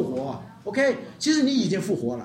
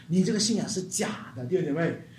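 normal talking voice

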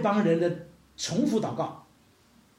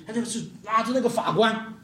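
ordinary delivery

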